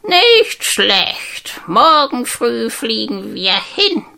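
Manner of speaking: high, odd little bird voice, tiring at the end; a sleepy bird settling down for the night; talking bird. Acted. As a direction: bird voice, drowsy and slowing. Bird speaking before sleep.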